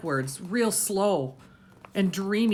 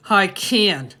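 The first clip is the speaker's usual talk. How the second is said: louder, defiant; insistent retort; 5 semitones higher, 11 dB louder than usual. A narrator's reading. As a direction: hold your ground. Boy insists against father.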